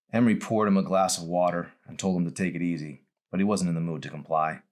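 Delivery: ordinary delivery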